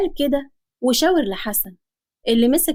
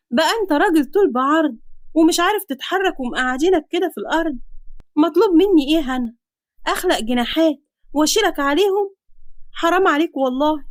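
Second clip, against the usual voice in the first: higher-pitched, exasperated reproach; indignant daughter; 5 semitones higher than usual. Acted. Daughter asks father why he won't protect her, indignant. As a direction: scold a father who hides behind his child.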